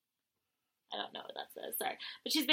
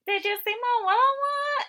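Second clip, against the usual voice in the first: much higher, put-on squeaky voice; hammed-up much higher mimicry; imitating high voice; 5 dB louder than usual. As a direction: mimic her odd high voice. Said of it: "her voice was super high-pitched".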